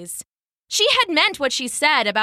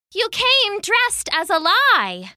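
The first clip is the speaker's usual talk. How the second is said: scared but angry; 11 semitones higher, 4 dB louder than usual. Acted. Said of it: "still scared but mad now too".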